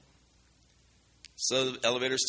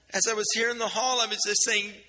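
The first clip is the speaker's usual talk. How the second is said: higher, fast, lightly voiced upset girl; distraught girl; 10 semitones higher, 5 dB louder than usual. Girl in the hallway tells him her story, upset and higher. Retelling the sobbing girl's words.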